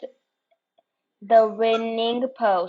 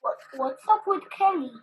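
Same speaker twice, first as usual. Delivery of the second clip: fast, higher bewildered question; puzzled; 9 semitones higher, 4 dB quieter, much faster than usual. Acted. Biscuit blinking at Kelly, bewildered. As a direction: ask in sudden confusion.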